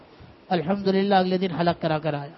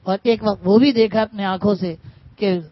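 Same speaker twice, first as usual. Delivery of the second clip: loud, pitched-up sermon delivery; preaching at full force; 4 semitones higher, 7 dB louder than usual. Acted. Urging students and scholars to take it to heart, loud preaching.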